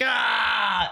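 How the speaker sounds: mock pained yell. Shown as a demonstration. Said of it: "I'm just like".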